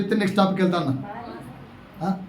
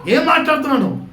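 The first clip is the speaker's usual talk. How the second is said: Job rebuking wife; 5 semitones higher, 3 dB louder than usual. Acted. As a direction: scold her as Job would. Pastor reads Job rebuking his wife.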